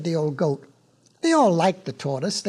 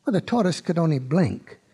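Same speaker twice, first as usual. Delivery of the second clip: ordinary delivery, nothing marked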